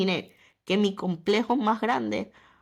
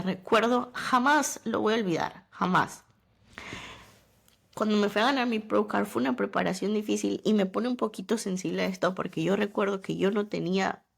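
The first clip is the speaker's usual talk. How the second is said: usual speaking voice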